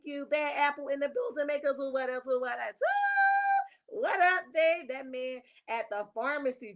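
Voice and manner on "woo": high loud woo; excited whoop. Real, not acted. Host whoops high and loud greeting the chat.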